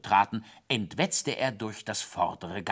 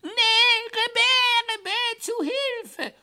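very high falsetto cry for help; panicked hare squeal; 5 dB louder, much slower than usual. A narrator's reading. Hare refusing to stop on the ice, squealing high. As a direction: squeal it, drawing out the last cry.

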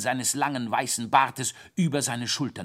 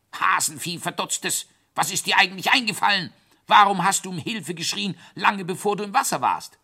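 raised, hurried telling-off; gruff scolding; 9 semitones higher, 5 dB louder, faster than usual. A narrator's reading. Thaddäus telling off the rescued hare, scolding gruffly. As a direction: scold in a high, indignant rush. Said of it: "schimpfte er barsch".

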